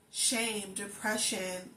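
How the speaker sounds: usual speaking voice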